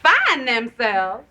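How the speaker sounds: slow, drawn-out, questioning disbelief; incredulous echo. Acted. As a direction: throw the phrase back slowly. Echoes his excuse in disbelief.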